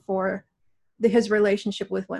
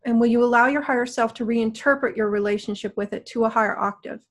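guided meditation. Acted. Guides a healing session.

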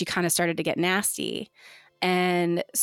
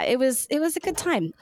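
sarcastic; 7 semitones higher than usual. Real, not acted. Sarcastic comment on ordeal.

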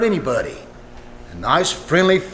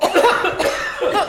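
smoke cough; 12 semitones higher than usual. Acted. Smoke fills room.

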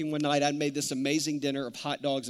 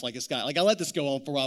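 ordinary talk, nothing marked

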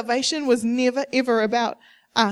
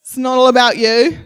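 loud scolding, "all" rising hard; parent scolding; 10 dB louder than usual. Retold or imitated. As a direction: tell off a child loudly, leaning on "all". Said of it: "Has anyone ever said that to their kids".

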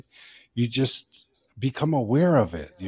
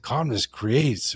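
slow quote; 3 semitones higher, slower than usual. Retold or imitated. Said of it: "when he said".

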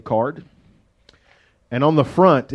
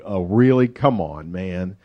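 dropping low and soft in disbelief; skeptical scoff; 7 semitones lower, 3 dB quieter than usual. Retold or imitated. Gideon brushing off the angel, quiet scoff. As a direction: roll your eyes and mutter it. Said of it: "And he's like".

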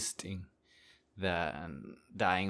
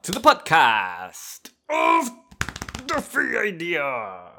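podcast host intro; 7 semitones higher, 10 dB louder, slower than usual. Acted.

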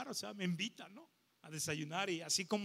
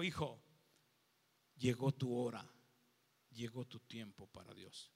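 tender hush; 8 semitones lower, 7 dB quieter than usual. Retold or imitated. The praying neighbor tells him his time for God has come, hushed and tender. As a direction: speak it like a gentle prophecy to a son.

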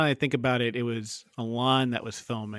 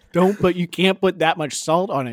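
mock alarmed outcry; 5 semitones higher, 6 dB louder than usual. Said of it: "and they were like".

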